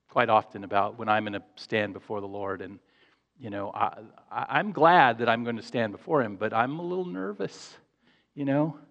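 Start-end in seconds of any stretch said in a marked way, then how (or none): none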